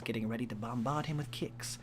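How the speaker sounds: usual speaking voice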